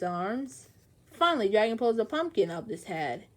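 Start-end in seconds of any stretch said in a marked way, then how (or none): none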